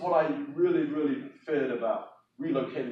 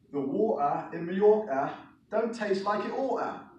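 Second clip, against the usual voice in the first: higher-pitched London accent; broad London pronunciation with lively higher pitch; 7 semitones higher, 3 dB louder than usual. Shown as a demonstration. Showing an audience how some Londoners talk, London accent. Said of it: "would pronounce it like this".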